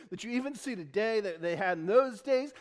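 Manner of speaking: ordinary talk, nothing marked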